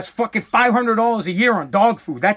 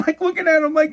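ordinary talk, nothing marked

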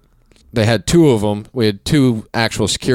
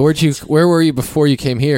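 asking, high-pitched; 7 semitones higher than usual. Retold or imitated. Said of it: "I go".

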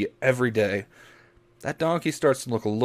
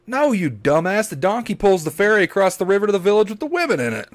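exasperated local; 9 semitones higher, 4 dB louder than usual. Retold or imitated. Local scolding the confused man.

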